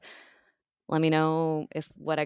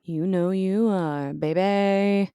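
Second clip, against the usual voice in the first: teasing; 4 semitones higher, 7 dB louder, much slower than usual. Pretend. Playful mock reply.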